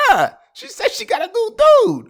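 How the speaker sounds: high, loud friend voice; exasperated friend. Retold or imitated. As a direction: play the exasperated buddy.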